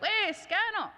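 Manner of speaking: a cheery hello called across the room; high, eager mock greeting; bright greeting call. Greeting the student warmly.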